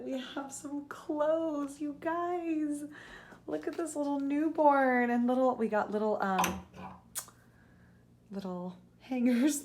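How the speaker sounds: high, slow cooing over tiny hangers; real high gush over newborn things. Real, not acted. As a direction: coo at the little clothes like a delighted mom-to-be. Gushing over tiny baby clothes.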